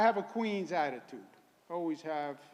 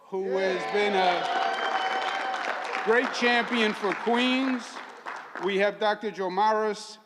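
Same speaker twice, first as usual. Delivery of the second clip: raised, projected announcer voice; rally introductions; 5 semitones higher, 6 dB louder than usual. Real, not acted. Host introducing community guests to the crowd, rally announcer. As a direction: announce each guest to the crowd, building to a loud finish.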